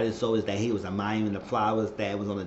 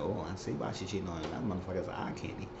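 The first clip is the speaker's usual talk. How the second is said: much softer, low confiding voice; hushed aside; 3 semitones lower, 10 dB quieter, faster than usual. Real, not acted. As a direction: murmur it like a guilty secret. Lusting aside to viewers.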